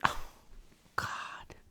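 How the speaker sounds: whispered oh God under a sigh; exasperated whisper. Real, not acted. Host fed up with pronoun debate, whispered. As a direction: breathe it out in despair.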